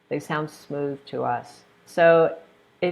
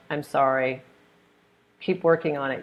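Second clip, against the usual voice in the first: usual speaking voice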